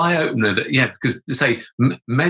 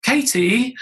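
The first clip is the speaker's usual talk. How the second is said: higher, drawn-out name; mock dismay; 10 semitones higher than usual. Retelling dread at being called.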